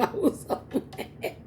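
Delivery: soft breathy laugh into the words. Real, not acted. Telling viewers about the unfair tests, laughing softly. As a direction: laugh it, barely voiced.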